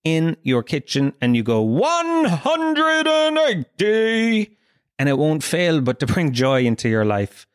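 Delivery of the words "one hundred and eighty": very high, drawn-out darts referee call; silly darts voice. Shown as a demonstration. Podcaster showing listeners how to cheer up, silly darts voice. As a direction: sing out the score, stretching each word to the limit. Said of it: "You do that silly darts voice".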